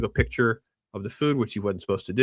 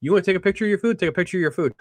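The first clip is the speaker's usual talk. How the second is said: mocking, dismissive; 7 semitones higher, much faster than usual. Date mocking Tyler.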